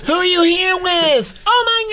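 squeaky high-pitched valley girl impression; shrill fake girly gushing; mocking high girly voice. Parodying the girl meeting Aziz in a high squeal. Imitating woman at comedy club.

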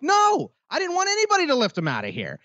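high, strained mock exasperation; exasperated retort. Retelling his fed-up answer to the cashier, high strained exasperation. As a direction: crack up high, fed up with her.

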